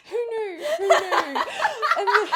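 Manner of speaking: laughing. Real, not acted. Laughing at her own surprise.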